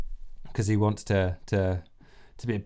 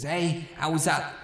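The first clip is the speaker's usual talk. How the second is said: rock frontman; 7 semitones higher than usual. Acted. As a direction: work the crowd from the mic. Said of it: "He goes".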